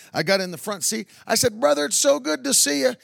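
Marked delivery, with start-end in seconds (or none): 1.6-2.9 high, eager welcome to a stranger; preacher gushing at the driver; excited greeting. Retold or imitated. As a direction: beam it out, thrilled to meet him.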